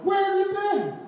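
high-pitched exasperated parental scolding; mock panicked parent. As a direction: demand it like a frantic parent. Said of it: "they were, like, panicking".

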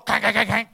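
loud clanging knocks; door knock noise. Retold or imitated. Friend knocking at the door early, loud clanging. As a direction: bang out the knocking. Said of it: "knocking on your door".

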